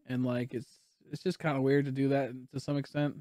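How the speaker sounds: low, subdued musing; soft and deflated. Real, not acted. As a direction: murmur it low, drained. Subdued chat after screaming fit.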